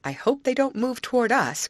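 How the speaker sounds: nervous storybook exclamation; gasped worry. A narrator's reading. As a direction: say it with anxious alarm. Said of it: "gasped Grace".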